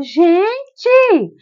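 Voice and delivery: high, loud call for attention; mother in labour shouting at the chatting nurses; exasperated shout. Retold or imitated. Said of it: "eu falava".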